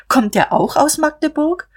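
high, climbing, leading question; pointed question. A narrator's reading. Sister asking about the bridegroom, pointedly questioning. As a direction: push the question up with mock innocence. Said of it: "fragte Emmy".

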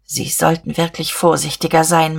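ordinary delivery, nothing marked

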